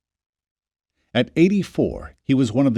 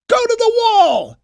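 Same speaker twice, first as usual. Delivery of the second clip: much higher, louder commanding shout; 6 dB louder than usual. Acted. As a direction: drive the workers back to the wall. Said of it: "Jacob shouted".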